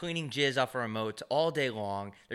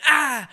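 high, loud mystic ah; magician's mystic ah; 14 semitones higher, 12 dB louder than usual. Retold or imitated. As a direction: cast a spell with it. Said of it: "and then went".

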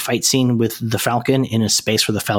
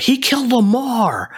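quoted accusation; 12 semitones higher, slower than usual. Retold or imitated. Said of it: "he's like".

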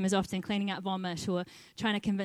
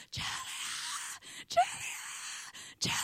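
imitated distraught scream of a name; retold screaming; 9 dB quieter, much faster than usual. Girl screaming for the volunteer who leaves, imitated scream. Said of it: "absolutely scream through the safe zone".

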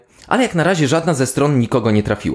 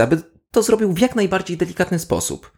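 ordinary talk, nothing marked

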